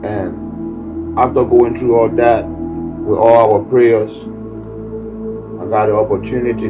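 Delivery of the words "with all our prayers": usual speaking voice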